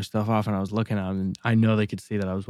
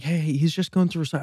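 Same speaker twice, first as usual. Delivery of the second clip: lightly imitated protest, pitched higher; placating partner; 6 semitones higher than usual. Partner defending the guy in the bins, lightly imitated protest.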